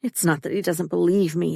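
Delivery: ordinary delivery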